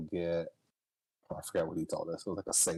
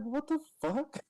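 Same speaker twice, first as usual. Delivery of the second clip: shocked reaction; slower than usual. Retold or imitated. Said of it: "I was like".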